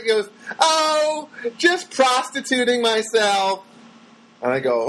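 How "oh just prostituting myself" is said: high, slow, goofy imitation; Jerry Lewis voice. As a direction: drag the words out in a high slapstick-comic voice. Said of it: "Won't sound like Jerry Lewis".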